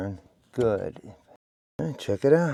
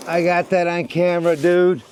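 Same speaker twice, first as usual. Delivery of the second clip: excited; 7 semitones higher, 6 dB louder than usual. Real, not acted. Spots a mouse under house.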